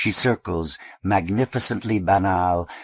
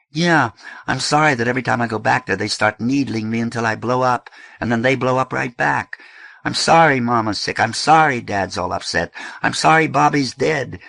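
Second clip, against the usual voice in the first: louder, fast and bitter; angry outburst; 6 semitones higher, 6 dB louder than usual. Acted. Eddie to his sister, raised in bitter anger. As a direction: throw the apologies back at her.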